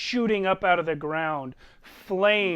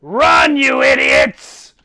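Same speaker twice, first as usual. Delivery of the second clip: loud, slow, booming order; roaring command; 11 dB louder, slower than usual. Acted. Abraxiel roaring at the party to flee. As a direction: bellow it like a god.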